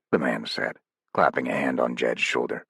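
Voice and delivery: ordinary delivery, nothing marked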